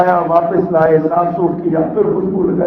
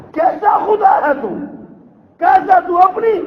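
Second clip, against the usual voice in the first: furious shout; 12 semitones higher, slower than usual. Retold or imitated. Cursing the idol found in filth.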